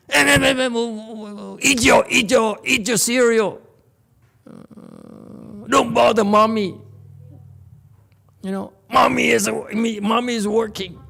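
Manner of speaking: high, loud mock yell at the kids; shrill-free but high scolding parent, hammed-up; mock yelling mother. Stressed parent hammed up, yelling at the kids over breakfast. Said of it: "you yell at your kids, say".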